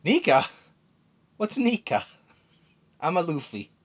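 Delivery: lightly voiced Luffy brushing it off; Luffy denying to Bonnie that he is Nika, carefree. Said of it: "And Luffy goes".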